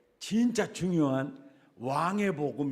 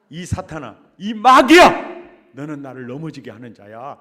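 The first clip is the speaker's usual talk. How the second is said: rebuking shout; 14 dB louder than usual. Retold or imitated. Jesus rebuking Peter as Satan, thundering rebuke. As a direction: roar 이 마귀야 like a stern master. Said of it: "호통을 치고".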